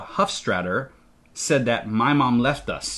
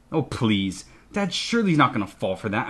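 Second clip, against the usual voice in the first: ordinary delivery, nothing marked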